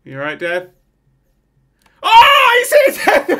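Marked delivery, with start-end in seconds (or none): 2.0-3.4 very high, loud yelp into laughter; excited shout. Real, not acted. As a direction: shriek at the sudden hit. Sim gets hit.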